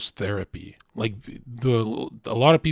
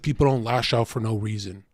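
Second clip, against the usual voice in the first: lower, softer, patient; calm wise brother; 5 semitones lower, 3 dB quieter than usual. Retold or imitated. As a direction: explain it gently like a therapist. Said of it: "He's like".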